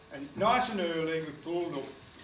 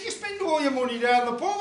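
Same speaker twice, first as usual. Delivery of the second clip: mock scolding wife; 6 semitones higher, 6 dB louder than usual. Wife scolds in puppet quarrel.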